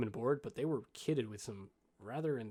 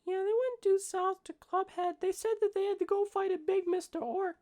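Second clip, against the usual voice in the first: put-on very high little kid voice; small child; 4 dB louder than usual. Young halfling answering the adults' questions, in a high child voice. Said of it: "this child cannot".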